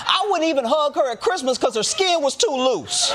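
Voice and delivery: high-pitched, mock-indignant grandkid disowning grandma; dismissive grandkids. Grandkids disowning grandma to escape her will, high mock protest. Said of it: "grandkids will be like".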